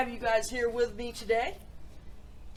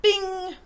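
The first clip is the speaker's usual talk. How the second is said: higher, louder ding imitation; ping it out like a microwave; sing-song mock microwave bell; 8 semitones higher, 10 dB louder than usual. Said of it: "Went the microwave".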